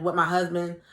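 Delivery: ordinary talk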